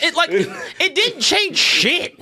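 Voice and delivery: high-pitched, laughing exasperation; worked-up agreement. Real, not acted. Heated agreement about kickoff rule.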